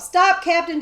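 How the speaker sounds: loud, high shout. A narrator's reading. Boy shouting to the coachman to stop, loud and high. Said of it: "Jemmy shouted".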